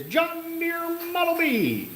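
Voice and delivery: high, slow, long-held call; drawn-out holler. Acted. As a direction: stretch it out high on one held note. Repeats the drawn-out tractor name.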